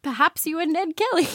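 acted jokey supposition; joking. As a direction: lightly pitch it up as a playful jest. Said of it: "Stevens says jokingly".

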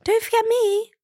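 imitating the friend left behind in the back seat, high and pleading; mock pleading friend. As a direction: squeak it like a clingy tagalong. Imitating friend scurrying after couple.

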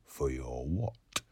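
put-on low, soft old fogey; slow, low grumbling query; snuffy fogey's grunt. A narrator's reading. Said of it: "grunted in snuffy Fogey's tone".

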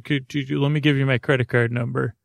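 usual speaking voice

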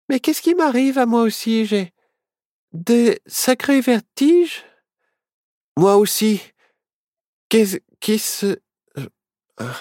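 slowing down, fading into sleep; mild drugged wooziness; dizzy, drifting off. Acted. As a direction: start high and puzzled, then fade out mid-sentence. Drugged children suddenly falling asleep.

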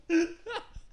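high squealing laugh; high burst of laughter. Real, not acted. Laughs after the quote.

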